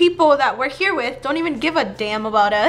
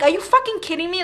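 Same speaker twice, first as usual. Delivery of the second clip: fast, higher angry burst; outraged disbelief; 5 semitones higher, faster than usual. Retold or imitated. After the driver refuses to help, fast outraged disbelief. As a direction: spit it out in shock.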